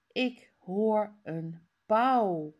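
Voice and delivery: ordinary delivery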